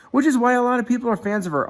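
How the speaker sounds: high, fast and eager; enthusiastic. Real, not acted. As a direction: gush about her quickly. Enthusing about Haley.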